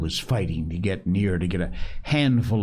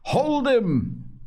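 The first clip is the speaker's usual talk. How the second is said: excited shout. Acted. Boys mobbing Robert.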